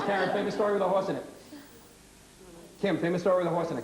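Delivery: rapid-fire classroom prompt; breakneck pace. Shown as a demonstration. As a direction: hurry the pupil with a fast question. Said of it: "moving at this breakneck speed".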